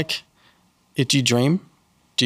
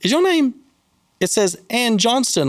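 imitating a delivery lady, much higher and slowed, asking in disbelief; lightly voiced surprised stranger, pitched way up; puzzled delivery lady; 9 semitones higher than usual. Delivery lady reads the name on the order, high puzzled question. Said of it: "and she's like".

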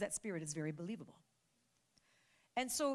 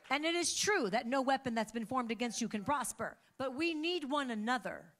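louder, stirring sermon voice; rousing preaching; 6 semitones higher, 9 dB louder than usual. Acted. Pastor calling the church to stand and pray, rousing. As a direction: lift them to their feet.

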